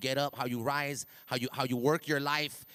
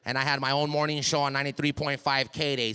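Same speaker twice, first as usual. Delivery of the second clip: ordinary talk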